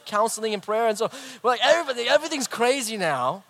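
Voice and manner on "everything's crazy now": normal talking voice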